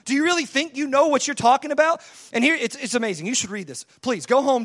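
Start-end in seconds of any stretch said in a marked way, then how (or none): 0.1-2.0 higher-pitched, incredulous challenge; disbelieving, building louder; God trashing Job. Acted. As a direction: scoff at Job's presumption. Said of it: "just absolutely trashing Job".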